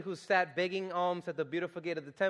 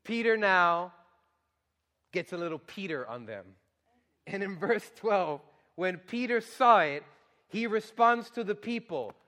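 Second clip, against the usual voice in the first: ordinary delivery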